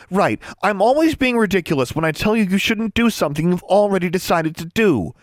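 high-pitched, indignant sarcasm; fuming. A narrator's reading. Anna warning a friend who won't listen, fuming. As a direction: fume at being dismissed. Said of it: "Anna fumed".

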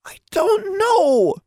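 very high, drawn-out frightened insistence; insistent. A narrator's reading. Cornered Tomo insists to Alexandra, wailing insistently. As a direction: insist in a high, tearful wail. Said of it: "Tomo insisted".

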